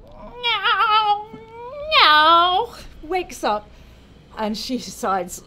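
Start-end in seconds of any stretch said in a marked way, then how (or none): 0.0-2.7 loud, high imitated baby wail; baby's cry. Child wakes up hungry in its mother's arms, wailing baby cry. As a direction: wail like a baby just woken. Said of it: "then the child wakes up, goes".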